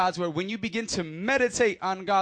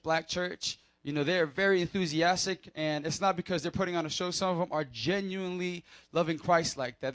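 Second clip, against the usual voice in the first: ordinary delivery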